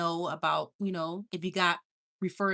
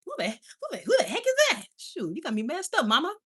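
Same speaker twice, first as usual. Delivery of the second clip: imitating son; 6 semitones higher than usual. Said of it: "He's like".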